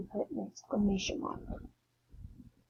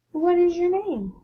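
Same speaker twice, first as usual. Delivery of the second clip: much higher, louder question; emphatic question; 8 semitones higher, 7 dB louder than usual. Shown as a demonstration. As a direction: call it out to the class. Question example read to class.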